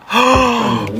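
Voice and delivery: big shocked intake of breath; startled gasp. Real, not acted. Driver startled by the car's voice, gasping.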